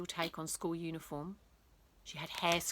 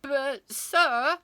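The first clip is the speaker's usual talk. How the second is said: put-on protesting boy; 6 semitones higher, 7 dB louder than usual.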